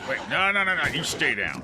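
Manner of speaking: loud, high string of refusals; alarmed command. Real, not acted. Player telling a rising monster to stay down, alarmed shout.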